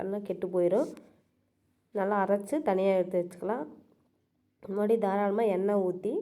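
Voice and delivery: usual speaking voice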